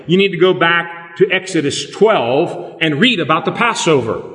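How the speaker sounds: ordinary talk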